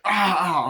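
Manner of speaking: high, loud mock yelps of pain; imitated pain cries. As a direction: yelp as if hurt while being lifted. Imitating injured man being lifted.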